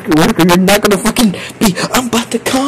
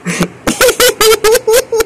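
very high giggling fit; helpless high-pitched cackle; laughing hard. Real, not acted. Streamer losing it over chat, high helpless laughter.

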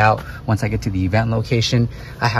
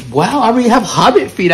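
surprised; 11 semitones higher, 4 dB louder than usual. Real, not acted. Surprised at his feet.

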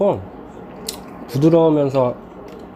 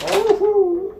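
high exclaimed whoo; much slower than usual. Real, not acted. Reaction while cooking.

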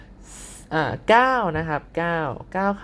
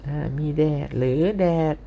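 ordinary talk

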